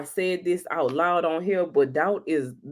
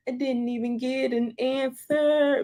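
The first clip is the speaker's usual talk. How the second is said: mock crying; 8 semitones higher than usual. Recounting crying last night.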